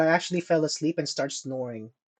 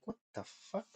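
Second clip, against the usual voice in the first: breathy whispered what the fuck; hushed retold disbelief; whispered aside; 24 dB quieter, faster than usual. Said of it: "And I'm like".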